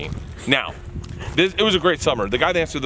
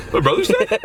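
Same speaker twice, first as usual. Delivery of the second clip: shocked grief; 8 semitones higher, 4 dB louder than usual. Retold or imitated. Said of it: "The guy who was all stoic goes".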